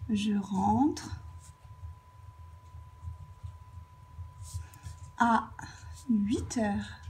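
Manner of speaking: long pauses before and after "à"; broken-up dictation. Shown as a demonstration. Teacher writing the example out, dictating "Je rentre... à... huit heures" in pieces.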